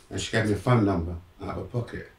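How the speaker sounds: ordinary delivery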